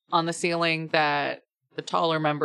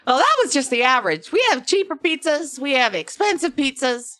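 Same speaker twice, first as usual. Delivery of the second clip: put-on high, loud, quick accented barkeep; hammed-up accent, pitched well up; bad-accent barkeep; 8 semitones higher, 5 dB louder than usual. Barkeep explaining pizza prices to a customer, hammed-up accent. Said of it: "okay with this accent".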